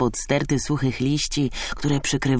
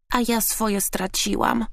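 high, plaintive little voice; saddened finch; 8 semitones higher than usual. A narrator's reading. Finch who lost her nest, sad high voice. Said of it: "zasmuciła się Zięba".

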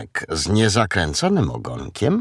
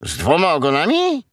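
quick, higher-pitched questioning echo; fox echoing in question; 6 semitones higher, faster than usual. A narrator's reading. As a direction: repeat it briskly as a surprised little fox. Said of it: "powtórzył Lisek".

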